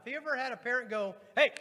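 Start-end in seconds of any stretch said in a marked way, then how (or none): 1.4-1.5 sudden loud attention-getting yell; parent's shout. Retold or imitated. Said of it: "finally got loud enough".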